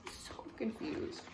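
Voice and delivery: slow unvoiced whisper; whispered aside. Real, not acted. Aside to listeners.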